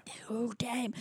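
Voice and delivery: hushed whispered echo; mock-mysterious whisper. Echoing the co-host's reveal about Gusto, playful whisper.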